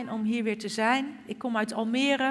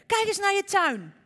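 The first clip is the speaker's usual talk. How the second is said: excited; 10 semitones higher, 6 dB louder than usual. Acted. Kikker shows the sprouting garden.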